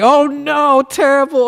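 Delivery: high, loud falsetto cry of dismay; mock-horrified high wail; alarmed monk. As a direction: squeal it like a horrified old monk. Monk crying out in heaven's library.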